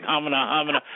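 a light, flustered stammered humana, humana; Jackie Gleason. Retold or imitated. Caught out in the control room, a hint of Gleason sputtering. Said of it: "I started sounding like Jackie Gleason on The Honeymoon".

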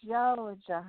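drawn-out Southern belle drawl; Southern belle accent. Shown as a demonstration. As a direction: stretch Georgia out in a Southern accent. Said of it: "I love that Southern Belle accent".

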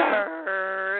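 a high, dumb grunt; Cartman-style dumb noise; dopey grunt. Retold or imitated. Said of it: "like that episode of South Park with Cartman".

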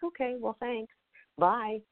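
high, light, quick brush-off to the spirit; mock polite goodbye. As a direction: play it as an amused, slightly nervous send-off. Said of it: "we're like".

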